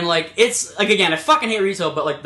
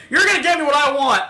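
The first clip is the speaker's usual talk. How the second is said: high mock outburst; hammed-up irate customer; demanding customer; 7 semitones higher, 4 dB louder than usual. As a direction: imitate a customer making an angry demand. Retold irate old customer.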